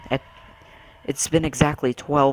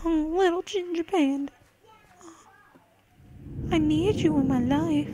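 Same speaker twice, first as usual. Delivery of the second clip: cooing pet voice. Real, not acted. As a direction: coo it like to a cute animal.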